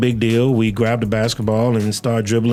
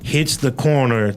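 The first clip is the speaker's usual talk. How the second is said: ordinary talk, nothing marked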